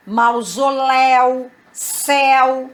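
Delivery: "mausoléu" and "céu" held on an open éu; open diphthong. Shown as a demonstration. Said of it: "o ditongo aberto na oxítona".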